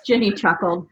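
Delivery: usual speaking voice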